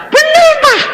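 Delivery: very high, loud chorus-like cry; shouted agreement. Acted. Children all agreeing with papa, shouting high.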